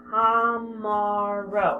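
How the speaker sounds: "Tomorrow" stretched very slowly; slow spelling read. Shown as a demonstration. Teacher sounding out tomorrow slowly for spelling. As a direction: drag out "Tomorrow". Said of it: "I would chunk this one up".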